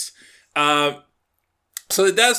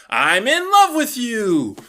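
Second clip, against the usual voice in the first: blurting; 7 semitones higher, 3 dB louder, slower than usual. Retold or imitated. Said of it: "she just blurts out".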